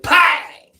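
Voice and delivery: loud, high mock gunshot bang. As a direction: blurt the bang like a sudden shot. Gunshot going off in house.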